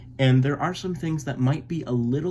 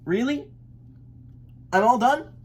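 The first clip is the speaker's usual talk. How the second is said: cheery duckling; 11 semitones higher than usual. A narrator's reading. Elmer cheerfully quits.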